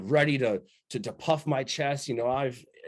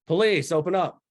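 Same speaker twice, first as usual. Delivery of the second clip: mock police call; 5 semitones higher, 6 dB louder than usual. Retold police call at door.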